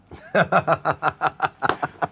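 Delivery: खुलकर हंसना; याद करके ज़ोर से हंसो; हंसी. Real, not acted. (प्रैंक याद कर ठहाका)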